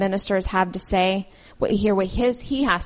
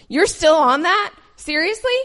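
disbelieving, higher and louder; can't believe she's still stuck on it; incredulous; 7 semitones higher, 4 dB louder than usual. Retold or imitated. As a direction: friend scolding her for still being upset, incredulous. Scott scolds her, retold.

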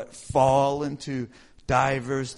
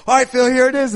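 higher, louder boss handing over; 8 semitones higher, 9 dB louder, faster than usual. Retold or imitated. Bosses loudly handing Phil the job. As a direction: announce it like a boss. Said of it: "then they're like".

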